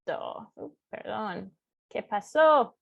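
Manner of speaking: ordinary talk, nothing marked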